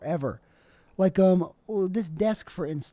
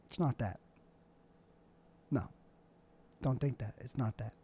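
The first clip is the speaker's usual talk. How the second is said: quiet, lower repeated it's not that; hushed denial; 7 semitones lower, 11 dB quieter than usual. Real, not acted. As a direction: drop to a low quiet no. Denying his room theme, hushed.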